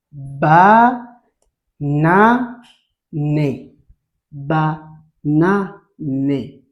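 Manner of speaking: slow, emphatic syllables for pupils to copy; repeat-after-me model. Shown as a demonstration. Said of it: "Répète avec moi". (modèle à répéter, syllabes bien détachées)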